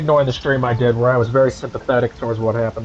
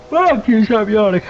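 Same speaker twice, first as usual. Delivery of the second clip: higher, louder, snapping at own yawn; loud yawn-fighting outburst; 8 semitones higher, 4 dB louder than usual. Real, not acted. Streamer scolds his own yawning, loud and high. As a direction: bark it at yourself mid-yawn. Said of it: "yawning again".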